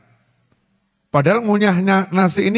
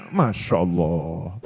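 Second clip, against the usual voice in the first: slow, much lower sigh of dismay; 13 semitones lower, 7 dB quieter, much slower than usual. Real, not acted. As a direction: groan it out in disbelief. Dismayed exclamation. (Masya Allah pelan, rendah, prihatin)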